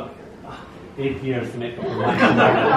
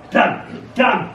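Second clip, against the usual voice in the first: mild mock shout; 7 semitones higher than usual. A narrator's reading. Wall mildly shouting as the dog steals the ball. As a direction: curse it like a put-out wall. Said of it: "shouts the wall".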